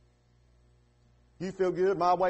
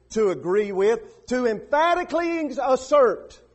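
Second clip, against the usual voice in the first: "emphatically assert" raised high and loud; emphatic assertion; 8 semitones higher, 9 dB louder, slower than usual. Shown as a demonstration. Preacher defining confession, "emphatically assert" punched high and loud. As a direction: build to a ringing peak on "emphatically assert".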